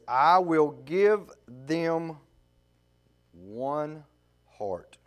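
measured, rising preacher's scripture reading; a preacher reading Ezekiel aloud. Acted. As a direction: proclaim the verses to the congregation.

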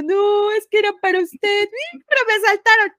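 voz aguda y llorosa, entre sollozos; llorando imitado. Retold or imitated. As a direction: lloriquea la disculpa al jefe, un poco más fuerte. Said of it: "chille, chille". (empleada llorosa explica al jefe que la asaltaron, con voz aguda)

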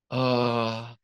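loud relieved ah; relieved sigh. Acted. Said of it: "sighed serotonin and dopamine".

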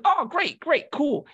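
high, loud imitation of a pleased recruiter; overeager approval. Recruiter hears the recruit is still keen, overeager. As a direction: jump up in pitch on each approving word. Recruiter's eager reply, retold.

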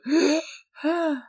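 sharp high gasp for air. Acted. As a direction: suck in breath like coming back to life. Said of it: "the gnome goes".

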